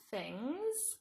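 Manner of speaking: each word spaced out slowly for writing; slow word-by-word dictation. Shown as a demonstration. Teacher dictating a sentence to children, slow and spaced. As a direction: dictate it one word at a time. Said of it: "So I'm going to say".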